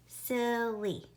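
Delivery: "silly" in two beats, sil and lee, falling at the end; split into syllables. Shown as a demonstration. Teacher repeating the syllable count, split into syllables. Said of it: "count the syllables we hear in silly".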